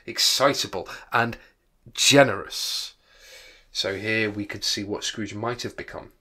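plain reading voice

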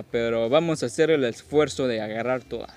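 normal talking voice

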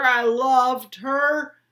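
slow, loud cry; anguished insistence. A narrator's reading. Father shouting back at his son, anguished. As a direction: drag it out in pain.

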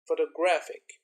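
"Photographic" with weight on "graph"; third-syllable stress. Shown as a demonstration. Teacher demonstrates shifting stress, "Photographic" stressed on the third syllable. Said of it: "second, and the third syllable".

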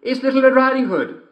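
put-on high young girl voice; girl's voice. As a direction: answer brightly like a little girl. Girl at door.

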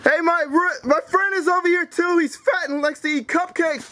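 high-pitched mocking holler; taunting yell. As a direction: shout insults about a friend to the neighbors. Yelling taunts out to the neighbors.

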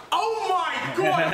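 high, slow, laughing shout. Real, not acted.

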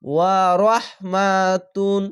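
ওয়া রহমাতুন chanted slowly, ending on a clear তুন; slow tajweed reading; Quranic recitation. Shown as a demonstration. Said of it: "এখানে তুন বলতে হবে কেন".

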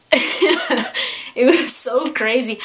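laughing through the words; giddy laugh while telling it. Real, not acted. Vlogger recalling finding lost candy, laughing.

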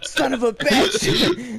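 slow, high curse through laughter; laughing curse. Real, not acted. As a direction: curse while cracking up. Laughing curse after losing.

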